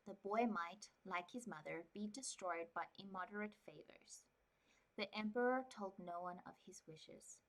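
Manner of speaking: calm, even storybook reading; story narration. Acted. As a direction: read it like a quiet novel. Reads novel aloud.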